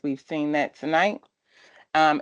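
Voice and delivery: ordinary delivery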